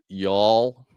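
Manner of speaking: drawn-out mock Southern American accent; amateur attempt at a Southern American accent. Shown as a demonstration. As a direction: have a go at a Southern drawl. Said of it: "proclamations of Jesus in a Southern accent".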